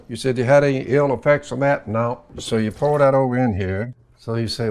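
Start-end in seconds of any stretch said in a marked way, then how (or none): none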